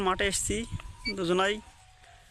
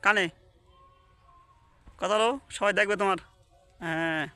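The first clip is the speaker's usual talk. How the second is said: high, sing-song coaxing of a pet bird; pet talk; 4 semitones higher than usual. Real, not acted. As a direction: sweet-talk the parrot into a kiss. Coaxing her pet parrot.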